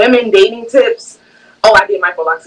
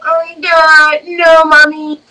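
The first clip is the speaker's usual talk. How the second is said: mock whiny daughter; 6 semitones higher, slower than usual. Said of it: "you're like".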